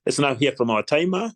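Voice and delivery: usual speaking voice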